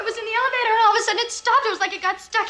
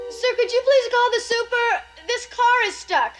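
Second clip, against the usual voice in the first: panicked pleading; 6 semitones higher, slower than usual. Acted. Trapped, pleading for help.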